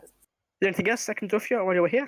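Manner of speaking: lightly voiced halfling merchant, polite. Shopkeeper offering help.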